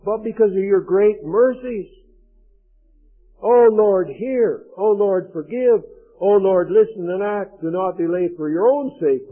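earnest, pleading scripture prayer; reading Daniel's plea for mercy. Acted. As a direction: implore God like Daniel. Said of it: "He says".

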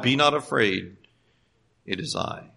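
soft, hushed, fading to a whisper; tender reassurance. Retold or imitated. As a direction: say it gently to a frightened child. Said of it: "the Lord Jesus would say to you".

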